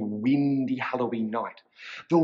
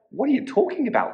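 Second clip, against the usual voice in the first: high, quick cat's question; puzzled little cat; 10 semitones higher, much faster than usual. A narrator's reading. Puzzled cat questions the witch, high and quick. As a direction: ask it fast in a high cat voice. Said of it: "which meant".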